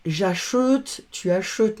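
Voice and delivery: "achète" said as a-sheut, with no open è; wrong closed vowel. Shown as a demonstration. Teacher shows pupils what not to say, "achète" as a-sheut. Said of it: "On va pas dire".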